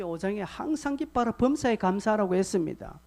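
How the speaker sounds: ordinary talk, nothing marked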